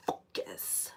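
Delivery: word comes out as a voiceless breath; voice failing. Real, not acted. Sick reader losing her voice, voiceless focus. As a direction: lips too dry to voice it. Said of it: "I can't say it. My lips are so dry".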